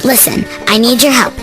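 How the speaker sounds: ordinary delivery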